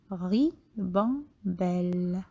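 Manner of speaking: ribambelle said slowly as ri-bam-belle; teacher scanning a word for pupils; scanned by syllable. Shown as a demonstration. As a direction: beat out ribambelle one syllable at a time. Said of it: "vous allez scander le nom".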